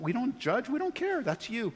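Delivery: normal talking voice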